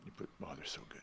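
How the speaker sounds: breathy whisper of delight. Real, not acted. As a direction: whisper it like a secret treat. Savouring remembered food.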